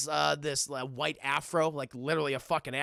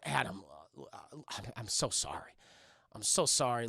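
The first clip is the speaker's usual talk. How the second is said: low, soft, hesitant apology; hushed, halting sympathy; tender condolence; 6 semitones lower, 5 dB quieter than usual. Acted. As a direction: console a grieving friend gently. [name] consoles the bereaved Adam.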